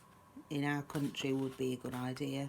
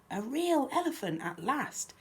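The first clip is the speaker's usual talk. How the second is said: higher, animated storybook exclamation; excited cry; 8 semitones higher, 5 dB louder, slower than usual. A narrator's reading. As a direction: voice the mice's delight, stressing real. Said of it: "cried Dan and Daisy".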